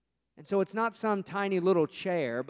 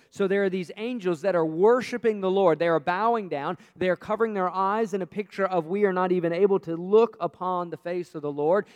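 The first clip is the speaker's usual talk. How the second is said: ordinary talk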